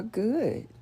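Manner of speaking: high-pitched "good" with a falling glide. Shown as a demonstration. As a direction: say good high, then let it drop. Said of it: "You could say".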